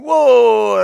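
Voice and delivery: loud, high, drawn-out cry of excitement; excited whoa. Retold or imitated. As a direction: whoop as the big wave lifts the boat. Retelling the boat's surge off the beach.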